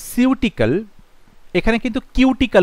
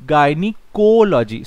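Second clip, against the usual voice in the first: Gynaecology said slowly, falling to a close; teacher modelling the word; slow clear pronunciation; 2 semitones lower, 3 dB louder, much slower than usual. Shown as a demonstration. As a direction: sound out gynaecology slowly and settle it down.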